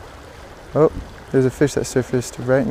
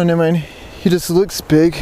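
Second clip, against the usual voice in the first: normal talking voice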